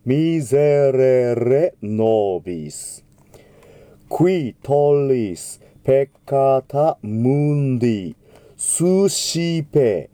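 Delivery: ミゼレレノビス with a voiced ゼ, slow. Shown as a demonstration. Teacher correcting S between vowels. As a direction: buzz the ゼ in ミゼレレノビス. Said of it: "少し濁った感じ".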